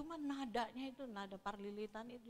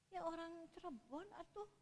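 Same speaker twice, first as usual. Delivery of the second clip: lightly voiced soft Cirebon accent, a little higher; 6 semitones higher, 8 dB quieter than usual. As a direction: mimic the gentle Cirebon friend. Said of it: "Cirebon kan lembut". (logat Cirebon yang lembut)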